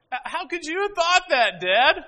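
high, loud, offended cry; indignant daughter. Retold or imitated. Daughter scolding her father, offended. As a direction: hurl it back at dad, stung.

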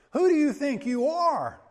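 high, loud indignant outburst; enraged retort. Retold or imitated. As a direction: explode at the accuser. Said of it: "he goes into a fit of rage".

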